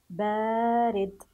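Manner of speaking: بارد slow, with a long held aa; lengthened sound. Shown as a demonstration. Teacher repeating the word for pupils, slow drawn-out بارد. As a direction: stretch out the first vowel of بارد. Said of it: "تمديد صوت الباء".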